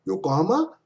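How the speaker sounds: "Yokohama" lifted high into a question; rising intonation. Shown as a demonstration. Teacher echoing a place name, "Yokohama" rising as a question. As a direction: swing "Yokohama" upward at the end.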